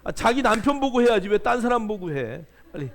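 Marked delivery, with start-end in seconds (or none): none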